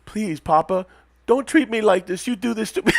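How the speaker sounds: high, put-on begging; pleading son act. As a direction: play a wronged son begging his papa. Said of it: "I thought he was gonna go".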